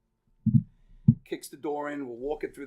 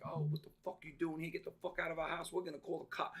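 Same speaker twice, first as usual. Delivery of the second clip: trash-talking squatters; 4 semitones higher, much faster than usual. Retold or imitated. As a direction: rattle off their threats under your usual volume. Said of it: "talking shit".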